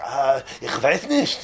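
drawn-out, halting reply in Yiddish; slow, dazed reply. Acted. Stricken passenger answering the driver, slow and dazed. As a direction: drag it out as if dazed.

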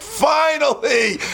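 high, drawn-out shout of relief; finally, at last, hurled out slowly; exasperated relief. Acted. Hungry beggar after endless waiting, slow exasperated shout. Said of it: "He's like".

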